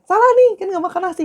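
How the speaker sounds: high, fast, hammed-up accusation; mocking accuser. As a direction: parody a petty critic. Mocking people who judge foreigners.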